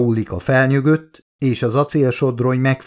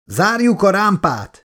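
higher yelled command to the crew; yelled order; 8 semitones higher than usual. Acted. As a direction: bark the order over the storm. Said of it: "üvöltött valaki a legénységből". (magasabb, üvöltött parancs)

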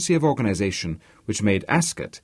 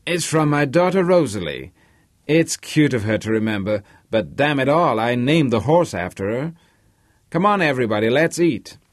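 higher-pitched, hearty Polish-American horse owner; loquacious American host; 4 semitones higher, 4 dB louder than usual. Acted. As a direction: play the talkative host rallying his guests. Narrator voices Harvey, the loquacious host.

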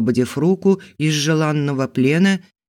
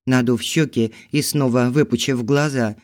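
ordinary delivery

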